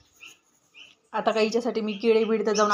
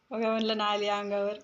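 ordinary delivery

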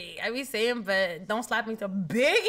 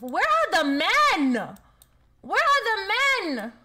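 calling out; 6 semitones higher, 5 dB louder, slower than usual. Acted. Calling out for men in game.

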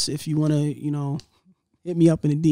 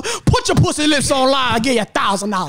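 higher-pitched mock-aggressive impression; imitating upset man; 5 semitones higher than usual. Imitating the man in the clip upset mid-haircut, mock aggressive. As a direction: mimic an upset man blurting out his offer. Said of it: "He sounded really upset".

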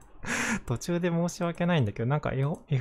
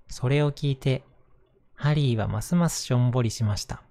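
gentle storybook narration voice; reading to children; 4 dB louder than usual. Acted. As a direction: read it aloud to little kids. Reads a picture book aloud.

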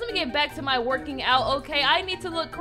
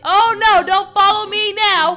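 loud scolding; 3 semitones higher, 8 dB louder than usual. Acted. Acted quarrel, being followed.

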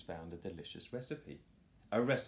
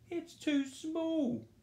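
much higher, slow wail; much slower than usual. Acted. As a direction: wail in childish complaint. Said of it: "wailed Morris".

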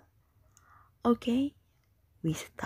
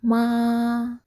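"Ma" held long on one high level pitch; 4 semitones higher, 7 dB louder than usual. Shown as a demonstration. Teacher models "Ma" for the class, high level tone. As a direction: draw out "Ma" high and steady, loud. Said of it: "The first tone is very high level".

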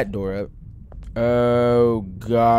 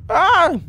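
startled yelp; 5 dB louder than usual. Real, not acted. Sudden scare.

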